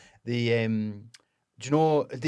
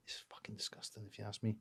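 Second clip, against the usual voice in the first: disgusted whisper; 4 semitones lower, 16 dB quieter than usual. Real, not acted. Telling friends about Edinburgh sauce, in a disgusted whisper. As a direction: drop to a whisper for the verdict on chip sauce.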